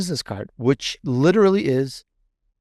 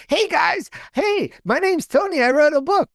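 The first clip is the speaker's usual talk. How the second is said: mock the overeager salesman, much higher; pushy self-promoter. Author mocking a pushy self-promoter, high eager voice. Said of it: "I'm not like".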